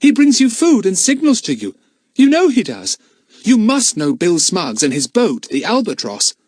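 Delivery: put-on high, pleading boy's voice; boy desperately insisting. A narrator's reading. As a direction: beg him to believe. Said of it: "said Philip desperately".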